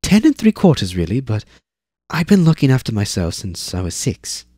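put-on high, proud little girl. Zane boasts to Eivor, high and proud. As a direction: puff up like a kid correcting her age. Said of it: "said Zane proudly".

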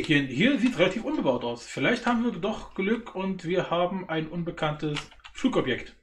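ordinary delivery

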